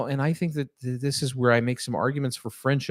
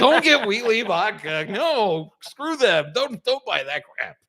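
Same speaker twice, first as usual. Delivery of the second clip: emphatic; 8 semitones higher, much slower than usual. Retold or imitated. Own quoted reply in story.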